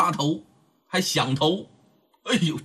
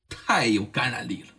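ordinary delivery

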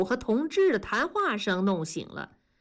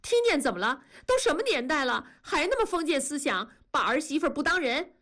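higher, fast, aggrieved complaint; hurt angry outburst; 7 semitones higher, faster than usual. Acted. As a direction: fire it back at him, wounded and fast. Wife keeps arguing with husband. (半夜委屈地冲丈夫快速发火)

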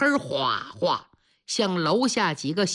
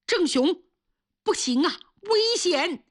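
alarmed wife; 9 semitones higher than usual. Acted. Wife stops her husband.

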